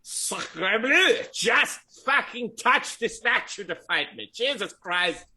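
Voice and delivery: hammy outraged French chef; Mister Anus French chef. Acted. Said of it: "you hear Mister Anus's voice. He's like".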